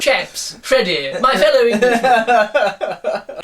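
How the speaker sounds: high, slow, laughing toff voice; posh English. Retold or imitated. Reader hamming up the British officer, in a posh English accent. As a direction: stretch it out like an English gent. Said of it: "Chaps," he said".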